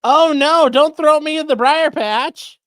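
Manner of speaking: loud, high hammed-up begging; mock despair, slow and loud; mock plea. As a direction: fake dread, like Br'er Rabbit. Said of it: "Alessi briar patched him".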